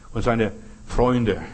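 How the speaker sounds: normal talking voice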